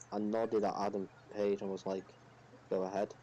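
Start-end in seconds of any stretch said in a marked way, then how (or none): none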